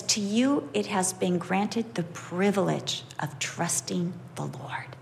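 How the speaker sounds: hushed, voiceless whisper reading the verse. Acted. Preacher reading the verse to his listeners in a whisper. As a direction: breathe the verse out like a secret. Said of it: "reading a verse in a whisper".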